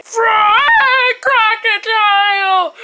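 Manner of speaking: high, loud and slow yell; drawn-out shout. Acted. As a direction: bellow the order across the house. Hungry demand for food.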